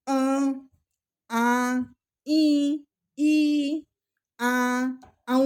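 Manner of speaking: "अ, आ, ई, ई, आ, औ" each through nose and mouth; separate, sustained nasal vowels with gaps between; nasalised vowels. Shown as a demonstration. Teacher giving examples of anunasik letters, "अ, आ, ई" nasalised. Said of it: "नाक और मुंह दोनों से ही ध्वनि निकल".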